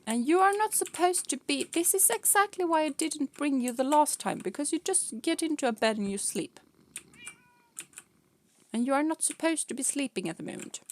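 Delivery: playful stern lecture to the cat; mock scolding. Real, not acted.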